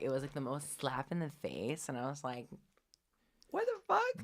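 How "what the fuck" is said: shocked outburst. Retold or imitated. Retold reaction to agency call.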